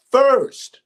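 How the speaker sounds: high, loud preacher's cry on first; preaching peak. Real, not acted.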